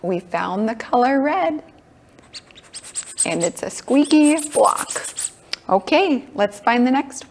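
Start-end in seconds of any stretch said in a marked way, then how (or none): none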